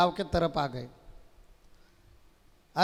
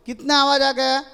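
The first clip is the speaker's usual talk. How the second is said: demanding shout; 5 semitones higher, 6 dB louder than usual. Acted. Slapping and demanding a count.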